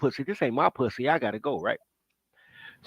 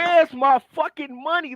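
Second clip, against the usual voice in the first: angry demand; 8 semitones higher, slower than usual. Retold or imitated. Retelling confronting his mother.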